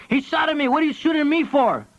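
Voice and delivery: screaming. A narrator's reading. Joe yells from the tree after being shot at, screaming. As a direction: shout it, outraged and scared. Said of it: "Joe screamed from the tree".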